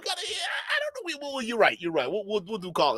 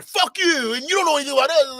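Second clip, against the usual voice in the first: hammed-up hostile retort, higher, louder and fast; mock angry outburst; 6 semitones higher, 5 dB louder than usual. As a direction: spit it out like the rude host. Streamer acts out hostile host.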